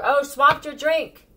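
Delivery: high, loud, slow taunting; mock gotcha. Reader acting out a drink thief, high and loud taunt. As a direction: drag it out like a sneaky trickster.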